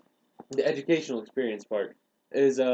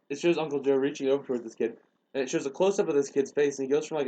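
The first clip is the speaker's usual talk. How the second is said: ordinary talk, nothing marked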